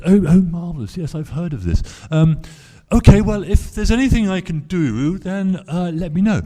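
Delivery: high, plummy, gushing lady's voice; a grand lady offering her help; posh gracious lady. Retold or imitated. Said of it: "terribly, terribly well-spoken, uh, lady".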